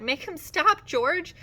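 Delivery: high, loud, desperate plea; terrified cry. A narrator's reading. Lenny bleating with terror to George, high and loud. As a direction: cry out for rescue. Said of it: "He cried".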